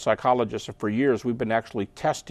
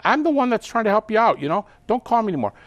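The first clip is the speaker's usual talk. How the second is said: mildly indignant, pitched up; hurt and fed up; 9 semitones higher, 3 dB louder, faster than usual. Acted. Would-be helper gives up on a friend, exasperated. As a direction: act out a wounded protest, higher in pitch. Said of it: "you get a little emotional".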